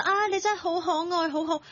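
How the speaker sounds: high-pitched adoring coo; doting on a pet. Acted. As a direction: gush over an adorable cat. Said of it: "無限嘅包容同愛護". (高聲錫住隻貓咁讚)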